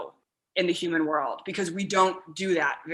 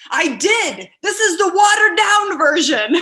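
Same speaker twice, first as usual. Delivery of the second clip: higher, louder defensive protest; higher, louder mock defensive reply; mock defensive husband; 11 semitones higher, 10 dB louder than usual. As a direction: protest like he already tried. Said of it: "And you're all".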